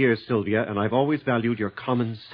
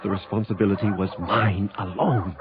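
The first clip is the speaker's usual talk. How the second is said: low noir voiceover; noir narration; 5 semitones lower than usual. A narrator's reading. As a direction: tell it grimly, looking back. Narrator voiceover over music.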